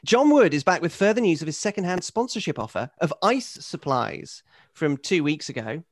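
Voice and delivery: usual speaking voice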